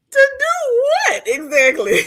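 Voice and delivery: high, loud laughing question; can barely get the words out through laughter; laughing hard. Real, not acted. Host laughing at the guest's claim, high and loud.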